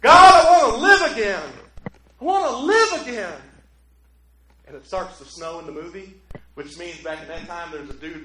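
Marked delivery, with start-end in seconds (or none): 0.0-3.4 high, loud pleading prayer; desperate plea. Retold or imitated. George on the bridge praying to live, desperate loud plea. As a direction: beg God for your life back. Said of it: "And then he says this".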